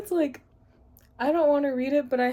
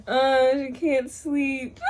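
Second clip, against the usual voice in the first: laughing mock; 3 semitones higher, much slower than usual. Mocking quote, laughing.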